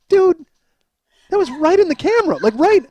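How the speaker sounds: high-pitched mock disbelief; incredulous friend. Friends react to the videotape, incredulous. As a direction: call him out, scandalized and high.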